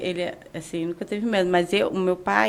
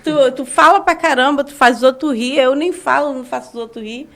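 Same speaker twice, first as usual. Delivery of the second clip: lively, loud and higher, with a laugh; animated retelling; 5 semitones higher, 5 dB louder than usual. Retold or imitated. Man retelling how he reassured his fiancée, animated. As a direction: push the disbelief, bright and emphatic.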